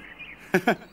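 short joyful laugh; happy laugh. Acted. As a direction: laugh with a friend after a tie. Laugh after friendly contest.

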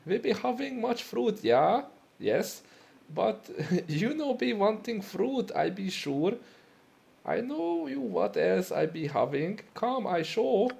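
high broken-English German trader; hammed-up German shopkeeper pitching fruit; German accent. Selling fruit to the customer in a high German accent. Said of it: "crank out my German accent".

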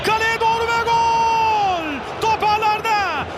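long held goal scream; screaming football commentator. Real, not acted. Equaliser goal call.

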